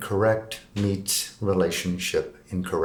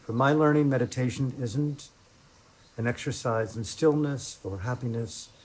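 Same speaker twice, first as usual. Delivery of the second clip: soft, unhurried meditation voice with long pauses; guided meditation; 7 dB quieter than usual. Acted. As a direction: ease them into sitting, quietly, leaving space between phrases.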